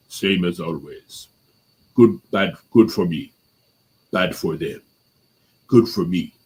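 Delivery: put-on movie-hero Russian accent, pausing between phrases. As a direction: imitate a terse Russian tough guy, unhurried. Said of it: "speaking with a Russian accent".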